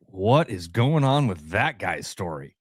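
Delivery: slow, curious musing about the man in shorts; curious wonder. Pretend. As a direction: wonder aloud about a stranger. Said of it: "so much more fun to be like".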